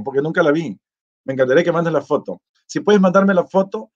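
ordinary talk

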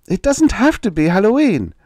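put-on high, loud boy's protest; indignant small-boy outcry. A narrator's reading. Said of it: "Robert protested".

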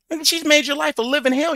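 high, loud outburst of disbelief; exasperated shout. Real, not acted. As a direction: blast it at him in disbelief. Exasperated rant at guest.

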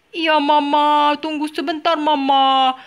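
put-on a lazy little boy stalling his mother; boy Jack voice. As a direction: Jack answering Mama, slow put-on boy voice. Toy boy Jack answers Mama.

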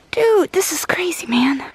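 whispered in awe; awed whisper. Real, not acted. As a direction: hush it out, amazed. Awed in canyon.

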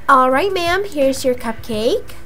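ordinary delivery

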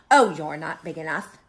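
loud, scoffing brush-off; grouchy bluff with a big oh; grouchy ladybug. Acted. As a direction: dismiss the rival with bluster. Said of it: "said the grouchy ladybug".

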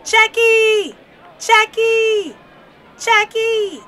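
high, loud cheering shout; full-voiced fan's holler, high and drawn out; real loud yell. A narrator's reading. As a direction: yell the name across a ballpark. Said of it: "Dad yelled real loud".

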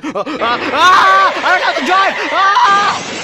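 high panicked laughing shout; man down shout. Acted.

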